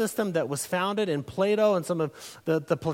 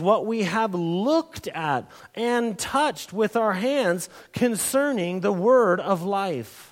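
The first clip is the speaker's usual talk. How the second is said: slow scripture reading; slower than usual. Acted. Reads the scripture verse slowly.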